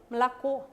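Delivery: Malacó with first A swallowed, weight on CÓ; reduced start, stressed ending on Malacó; final-syllable stress. Shown as a demonstration. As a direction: say Malacó as mla-KÓ. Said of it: "cómo la palabra como sube la entonación".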